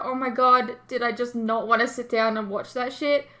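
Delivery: ordinary talk, nothing marked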